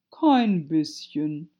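slow, loud, drawn-out denial; ponderous caterpillar voice; dismissive caterpillar. A narrator's reading. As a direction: stretch it out with disdain. Caterpillar curtly contradicts Alice.

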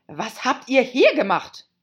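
high, loud imperious questioning; angry demand. Acted. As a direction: demand an answer as an angry queen. Angry queen questions the gardeners.

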